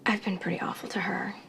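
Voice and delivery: soft unvoiced whisper; whispered apology. Wife whispering her regret to her husband.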